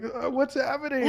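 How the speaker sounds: high, slow bewildered laugh through the words; laughing confusion. Retold or imitated. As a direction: laugh in dazed disbelief. Bouncing after crash, confused.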